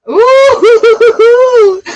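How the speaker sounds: high squealing ooh with a laugh; a delighted squeal at what she sees; excited ooh. Real, not acted. Tarot reader squeals at a card.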